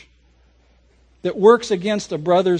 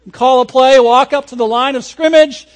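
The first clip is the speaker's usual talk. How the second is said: normal talking voice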